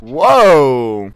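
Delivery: long falling loud whoa. Real, not acted. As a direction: let out a big amazed whoa. Reacting to rifle twist.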